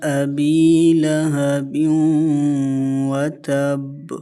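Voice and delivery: very slow, drawn-out tajweed chanting; Quranic recitation. Shown as a demonstration. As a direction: recite it slowly, stretching every vowel. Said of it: "اس پہلی آیت میں". (تجوید کے ساتھ بہت آہستہ تلاوت)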